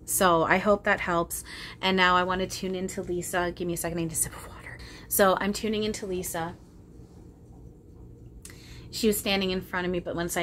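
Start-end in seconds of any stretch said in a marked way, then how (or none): 3.5-4.8 quick unvoiced aside; hurried whisper while reaching for water. Real, not acted. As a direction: whisper it off to the side. Aside while pausing to drink.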